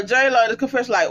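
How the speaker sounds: ordinary talk, nothing marked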